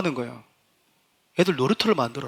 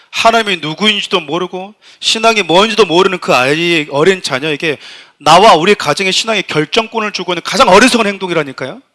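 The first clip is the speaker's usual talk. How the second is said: 크게 힘주어 설교하듯; 목소리를 높여 회중에게 강하게 역설; 7 dB louder than usual. Real, not acted. (힘 있게 외치는 설교조)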